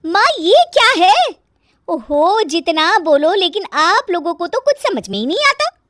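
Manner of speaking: put-on high, loud complaining young wife; exasperated, high-pitched household grumble; nagging daughter-in-law, exasperated. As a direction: fuss loudly at the in-laws in a high voice.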